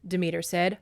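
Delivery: normal talking voice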